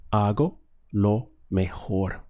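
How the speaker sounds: hago lo mejor, slow, the J in mejor a breathy H, meh-HOR; Spanish J as H. Shown as a demonstration. Teacher slowly modelling hago lo mejor for learners. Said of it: "Notice the H sound over here, over the J".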